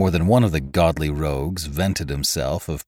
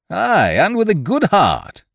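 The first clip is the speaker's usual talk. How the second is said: loud, high, breezy cheerfulness; cheerful; 6 dB louder than usual. A narrator's reading. Captain cheerfully mocking the begging castaway. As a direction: toss it off merrily. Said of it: "said Ned cheerfully".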